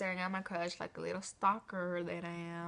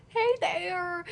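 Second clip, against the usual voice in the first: 1 laughing greeting; 10 semitones higher, 4 dB louder than usual. Real, not acted. Greeting the girl if watching.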